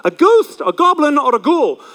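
mock spooky teacher chant listing Halloween costumes; spooky sing-song.

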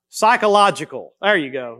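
normal talking voice

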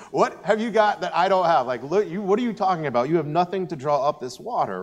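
an indignant Samaritan woman scoffing at the stranger; incredulous challenge. Retold or imitated. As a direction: scoff at him like he's talking nonsense. Woman challenging Jesus at well.

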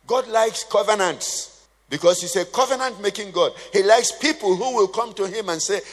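normal talking voice

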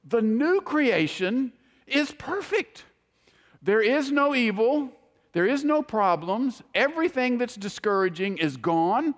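imitating a man overwhelmed by wonder, pitched high; awed testimony. Preacher quoting a man who saw the new creation, awestruck. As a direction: retell it as breathless superlatives, each phrase lifted high. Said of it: "he runs out of superlatives".

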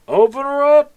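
high, loud guard's yell. Acted. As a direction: shout the order up to the gate crew. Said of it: "turns around and just yells".

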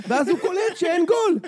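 giddy high-pitched storytelling; excited retelling. Retold or imitated. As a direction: rattle it off in a rush of delight. Excited retelling of goalkeeper celebrating.

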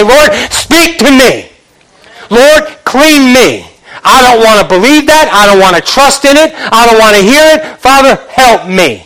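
fervent prayer. Acted. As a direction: cry out in acted desperate prayer. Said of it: "get on your knees and say".

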